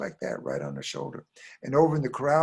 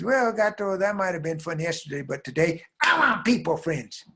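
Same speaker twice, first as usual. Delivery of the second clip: put-on high, louder child voice; demanding little girl; 7 semitones higher, 4 dB louder than usual. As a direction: build to a child's wailed demand. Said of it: "And the girl says".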